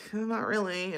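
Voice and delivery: small, soft, hesitant refusal; softly reluctant; reluctant reply. Acted. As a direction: decline meekly, under your voice. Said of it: "And I'm like".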